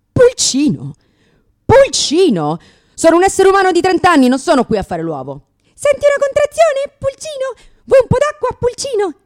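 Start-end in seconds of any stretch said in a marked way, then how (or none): 0.1-4.4 loud, high indignant echo; indignant repeat; outraged disbelief. Real, not acted. 5.7-9.1 very high, loud cooing voice; mock a ditzy cooing midwife; hammed-up baby talk; sugary midwife.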